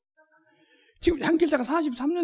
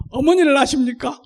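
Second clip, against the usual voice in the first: recruiter's question; 10 dB louder than usual. Shown as a demonstration. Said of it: "연습을 해보겠습니다".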